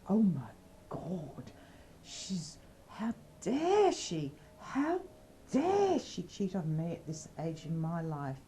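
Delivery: theatrical wail of outrage; outraged old lady. Acted. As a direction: play the scandalised, betrayed partner. Betrayed old lady finds out.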